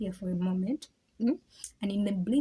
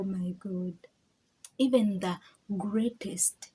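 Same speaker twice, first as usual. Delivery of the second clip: normal talking voice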